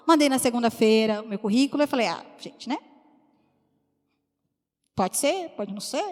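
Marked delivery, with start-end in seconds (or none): 2.1-6.0 breathy whispered shrug, much softer; whispered doubt. Retold or imitated. Woman sending her CV to herself, whispered doubtful shrug. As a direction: say it under your breath, unsure.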